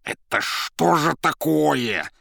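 медленно, высоко, с возмущением; растянутое злое негодование; почти шипя от злости. A narrator's reading. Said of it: "почти зашипела Зазелла". (почти шипя, возмущённо)

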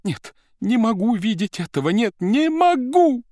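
high, loud wail stretched out; shouting. A narrator's reading. Secretary cries out before fleeing, high loud wail. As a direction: scream it and run out. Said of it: "закричала Анна Ричардовна".

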